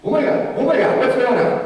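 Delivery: high, loud panicked cry; freaking out. Acted. Mary runs to Elizabeth, frantic and panicked. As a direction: blurt it out in a rush of panic. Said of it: "just freaking out".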